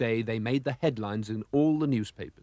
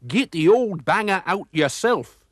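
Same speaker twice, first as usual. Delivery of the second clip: higher, louder mocking jeer; 7 semitones higher, 6 dB louder than usual. A narrator's reading. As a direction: taunt him, slow and loud. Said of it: "jeered McClurk".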